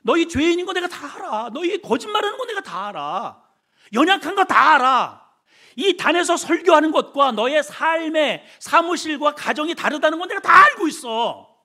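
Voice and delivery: loud, high impassioned divine voice; God speaking. Acted. Preacher voicing God to sinners, fervent and loud. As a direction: plead it out like God himself. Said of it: "하나님은 항상 이렇게 말씀하셔요".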